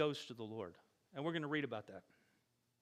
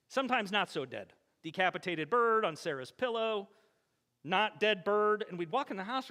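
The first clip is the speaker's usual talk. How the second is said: normal talking voice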